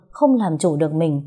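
ordinary delivery, nothing marked

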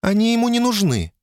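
thin little voice. A narrator's reading. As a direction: squeeze it up thin. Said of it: "тоненьким голосом проговорила она".

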